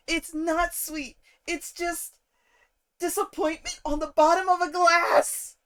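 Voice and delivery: real, worked-up tirade about crunchy sugar in tea; passionate rant. Real, not acted. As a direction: vent your frustration, voice cranked up. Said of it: "I got a little passionate there".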